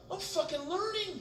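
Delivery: high, delighted inner voice; proud, excited squeak of discovery; high proud realization; thrilled. Retold or imitated. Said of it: "I'm like".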